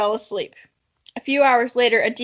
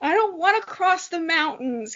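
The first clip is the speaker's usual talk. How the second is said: higher, slow whiny complaint; 6 semitones higher, slower than usual. Acted. Kiva whining about crossing mountains, whiny voice. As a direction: whine it like a tired kid. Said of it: "Kiva complained in a whiny voice".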